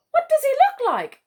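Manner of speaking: very high loud bird voice; cheeky kookaburra. Acted. Smirk the kookaburra asks.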